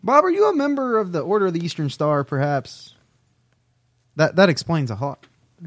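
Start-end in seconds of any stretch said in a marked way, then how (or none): none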